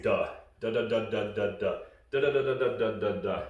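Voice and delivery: a rhythmic run of quick "duh, duh, duh"; steady staccato "duh" syllables; feeding call demo. Shown as a demonstration. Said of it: "that sounds something like this".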